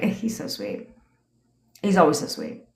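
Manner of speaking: hushed, dreamy gushing; breathless swoon over a text; dreamy whisper. Shown as a demonstration. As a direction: sigh it like a smitten girl. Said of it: "You'd be like".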